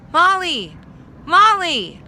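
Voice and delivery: very high, loud distant call. A narrator's reading. Dog calling Molly from far away, loud high cry. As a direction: call her name across the forest.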